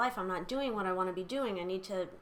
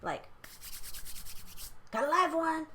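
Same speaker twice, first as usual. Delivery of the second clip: gleeful con man. Retold or imitated. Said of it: "he saw this as".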